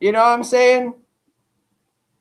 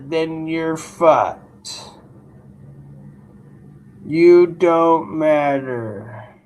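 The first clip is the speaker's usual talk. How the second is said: a lower, very slow, heavy mock announcer voice; mock deep announcer; 6 semitones lower, much slower than usual. Streamer taunts chat in a slow, lower mock voice. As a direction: drag every word out low like a doom announcer.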